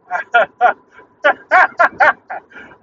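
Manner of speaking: hearty burst of laughter; loud laugh. Real, not acted. Fan laughing at his team, a loud burst.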